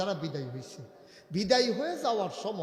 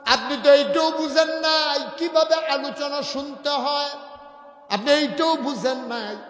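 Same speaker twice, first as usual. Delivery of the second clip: loud, high chanted rebuke; full-force preaching; 8 semitones higher, 12 dB louder, slower than usual. Real, not acted. Preacher scolding inattentive listeners, loud high chanted rebuke. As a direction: cry it out to the crowd on a held high note.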